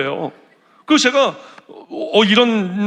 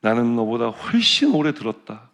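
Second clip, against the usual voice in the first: gentle voice of God; 9 semitones lower than usual. Retold or imitated. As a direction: let it land gently, slowly. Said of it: "더 놀라운 얘기를 하시더라고요".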